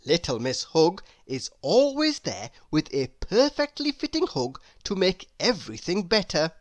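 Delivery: ordinary delivery, nothing marked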